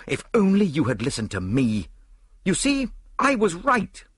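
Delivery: high, emphatic I-told-you-so; reproachful outburst. A narrator's reading. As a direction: reproach your friend, drawing out the words. Reproaching Poirot after the theft.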